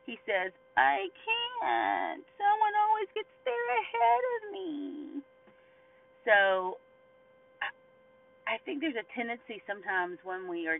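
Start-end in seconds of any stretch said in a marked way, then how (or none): 0.7-5.2 high, drawn-out pitiful whine; whining. Acted. Sick man making excuses to Jesus, whining. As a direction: whine it way up high like a helpless invalid. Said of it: "it sounded like he was whining".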